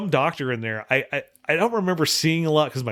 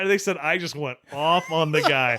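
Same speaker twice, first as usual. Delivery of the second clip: ordinary talk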